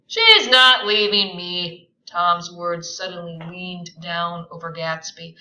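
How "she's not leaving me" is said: firm. Acted. Tom firm.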